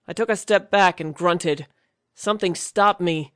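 plain reading voice

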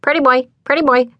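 high, quick parrot-like croak; croaking bird voice. A narrator's reading. Bird in a pissed-off flock, high quick croak. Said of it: "a new voice croaked".

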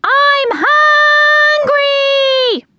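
high, drawn-out piercing squeal; hungry chick scream. A narrator's reading. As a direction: scream it like a baby bird begging for food. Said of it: "they would scream".

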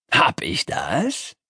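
slow, drawn-out question; mock innocence. A narrator's reading. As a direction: stretch it out ominously, rising at the end. Ominous reply before firing.

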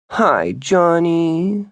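put-on high, slow sugary baby talk; drawn-out high baby-voiced hello. A narrator's reading. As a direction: coo the greeting like a little girl. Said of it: "she offered in baby voice".

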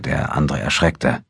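ordinary delivery

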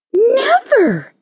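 very high, outraged cry; indignant. A narrator's reading. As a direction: refuse with shocked indignation. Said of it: "said the caterpillar indignantly".

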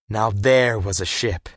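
ordinary delivery

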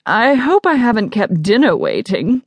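high-pitched, lofty politeness; be grandly polite to your hosts; haughty guest. Acted. Arrogant guest greets his hosts.

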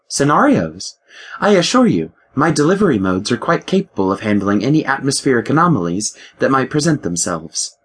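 ship AI voice. Acted. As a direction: play the ship's mind, a touch superior. The ship AI speaks.

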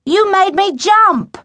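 high, loud, drawn-out complaint; indignant shout, pitched high; yelled back. A narrator's reading. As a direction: protest loudly after a fright. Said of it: "he yelled back".

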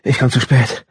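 high, alarmed waking cry with a laugh; startled panic. A narrator's reading. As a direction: gasp it out in sudden alarm. Waking late, panicked exclamation.

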